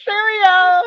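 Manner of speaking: laughing put-on British; British accent. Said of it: "where's your British accent?".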